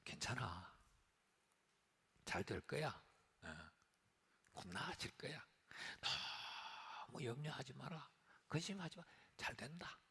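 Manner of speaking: a soft hushed voice telling the worrier all will be fine; soothing reassurance. Retold or imitated. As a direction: gently console the worrier. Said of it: "이런 이야기죠".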